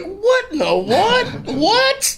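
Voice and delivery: high, slow, laughing what; stunned disbelief. Retold or imitated. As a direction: gape at the billboard in disbelief. Seeing billboard, stunned.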